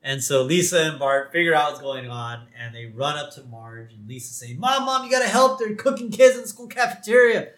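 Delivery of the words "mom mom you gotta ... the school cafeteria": put-on high, loud, urgent kid plea; mock panic. Daughter begging mom for help, high mock panic. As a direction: plead much higher, in hammed-up alarm. Lisa pleads with Marge for help.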